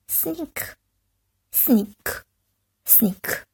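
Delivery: hushed sneaky whisper. A narrator's reading. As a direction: creep it out softly. Narrator sneaks.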